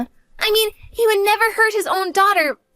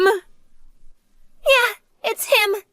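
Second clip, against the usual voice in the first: tearful fear; 4 semitones higher, much slower than usual. Acted. Daughter confirms father is there.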